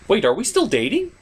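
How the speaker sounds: ordinary talk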